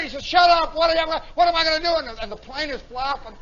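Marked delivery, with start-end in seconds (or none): 0.2-1.9 high, loud, rushed mock snap; frantic retort. Lieutenant answering Johnson in the emergency, frantic retort. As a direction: snap back fast, at a loss. Lieutenant snaps back in panic.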